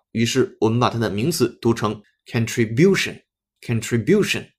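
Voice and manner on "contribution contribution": "contribution" with the third syllable BU (byoo) stressed. Shown as a demonstration. Teacher modelling the noun for learners, "contribution" stressed on BU. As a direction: move the beat back to BU in "contribution". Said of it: "重音在那个biu那个音阶上".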